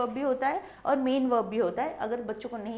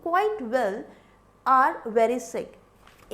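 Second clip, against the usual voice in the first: "are very sick" drawn out and loud; teacher stressing the wrong verb; emphatic slow reading; 9 dB louder, much slower than usual. Shown as a demonstration. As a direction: lean hard on "are" to show the error. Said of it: "are कहाँ से आएगा".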